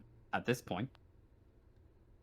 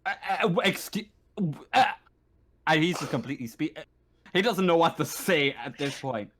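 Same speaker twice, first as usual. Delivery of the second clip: ordinary talk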